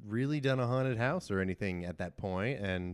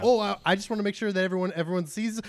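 flustered rush; 8 semitones higher, 4 dB louder, much faster than usual. Retold or imitated. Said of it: "I'm like".

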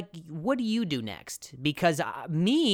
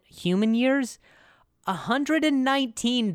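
higher and louder, showing off the number; proud, raised reveal; excited announcement; 9 semitones higher, 4 dB louder than usual. Real, not acted. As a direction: present it like a record. Announcing the dog's age.

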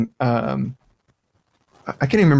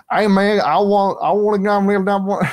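imitating his old thick Southern accent, pitched higher; 8 semitones higher than usual. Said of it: "mush mouth with, like, a Southern accent".

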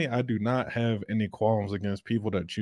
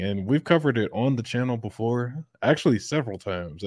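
normal talking voice

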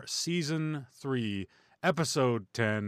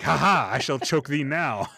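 archaic villain; 3 semitones higher, 7 dB louder than usual. Pretend. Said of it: "be like".

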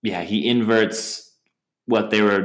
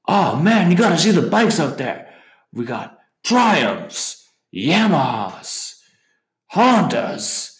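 imitated Duke; 9 semitones higher, slower than usual. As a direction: savor the bike names in a put-on voice. Imitating Duke hiding behind bar.

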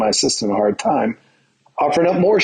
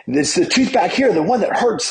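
higher-pitched mock protest; retold fussy complaint, pitched up; patient having a fit; 7 semitones higher than usual. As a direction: imitate an indignant patient throwing a fit. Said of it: "starts to have a fit, saying".

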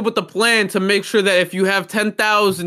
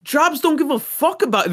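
ordinary talk, nothing marked

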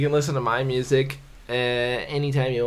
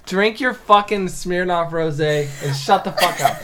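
mock scolding; 6 semitones higher, 8 dB louder than usual. Mock-scolding friend, laughing.

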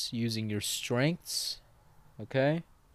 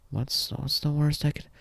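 whisper; 6 semitones higher than usual. Real, not acted. Muses aloud about drugs.

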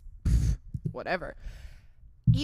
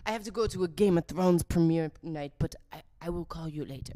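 put-on French accent. Imitating the French date excusing himself.

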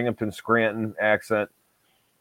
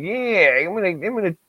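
exaggerated Endicott accent, pitched up and drawn out; 9 semitones higher than usual. Mimicking people from Endicott for a friend, exaggerated Endicott accent. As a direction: mimic Endicott locals, high and stretched. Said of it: "these people from Endicott, they, like, you're like".

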